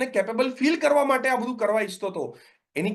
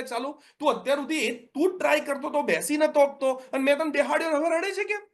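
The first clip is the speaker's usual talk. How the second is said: exasperated parent; 8 semitones higher than usual. Retold or imitated. Parent puzzled by crying child.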